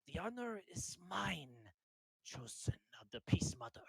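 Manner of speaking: kneel and pay your respects; solemn knight. Acted. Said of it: "he kneels down ... he says".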